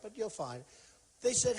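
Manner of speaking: ordinary delivery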